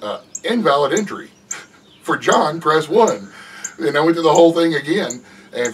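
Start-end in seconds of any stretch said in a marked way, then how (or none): 0.4-3.3 raised, loud machine voice, cracking into a laugh; automated phone menu. Retold or imitated. Man keeping up his fake phone menu for the caller, robotic. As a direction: keep up the robot act while laughing.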